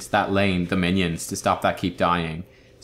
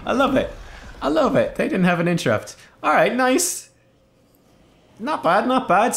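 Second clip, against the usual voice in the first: delighted; 13 semitones higher than usual. Real, not acted. Delighted by the play.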